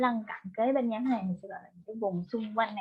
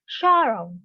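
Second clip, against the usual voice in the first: "sorrow" with a strong, louder first syllable; teacher modelling "sorrow" with the beat on "sor"; first-syllable stress; 5 dB louder than usual. Shown as a demonstration. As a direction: stress "sorrow" as SOR-row, falling away after. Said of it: "stress on the first syllable".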